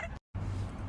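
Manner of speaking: breaking into laughter; real laugh. Real, not acted. Comic laughs at his snake joke, real laugh. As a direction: laugh after landing the punchline.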